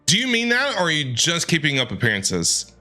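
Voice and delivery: ordinary talk, nothing marked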